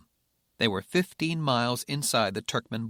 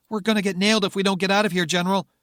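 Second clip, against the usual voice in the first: panicked rushed shout; 9 semitones higher, 7 dB louder, faster than usual. Acted. Rebecca panicking, fast.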